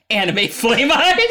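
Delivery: slow, loud and laughing. Real, not acted. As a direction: chant it slowly while cracking up. Others join the joke.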